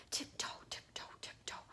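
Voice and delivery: hushed, sneaky tiptoe whisper; soft, voiceless storytelling whisper. Shown as a demonstration. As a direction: whisper it as if creeping through a dark tunnel. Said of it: "You can kinda whisper through this bit".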